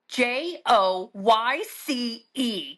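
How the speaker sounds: very slow, letter by letter, pointed; slow deliberate spelling back. Retold or imitated. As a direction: spell it out slowly, making a point. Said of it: "real Joyce comes out".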